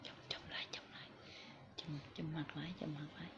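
very soft, secretive whisper. Real, not acted. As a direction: whisper it under your breath. Nói thầm lúc trùm mặt. (thì thầm rất khẽ)

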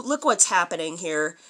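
ordinary delivery, nothing marked

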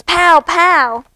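two high, loud bangs; drum noises. A narrator's reading. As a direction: hit each pow like a drum. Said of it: "go the drums".

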